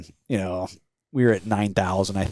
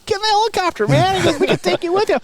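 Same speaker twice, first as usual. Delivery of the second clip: much higher, eager call; excited urging; 5 dB louder, faster than usual. Retold or imitated. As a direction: hurry them aboard, high and bright. Voicing pilot urging.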